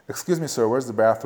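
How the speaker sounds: smooth American English; American accent. Shown as a demonstration. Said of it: "במבטא אמריקאי".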